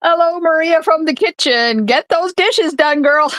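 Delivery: teasing call. Pretend. Teasing guest in the kitchen.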